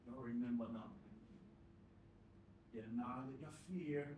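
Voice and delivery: very soft, mostly low voice; hushed. Acted. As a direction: speak low, as in a private memory. Hushed exchange voiced with grandad.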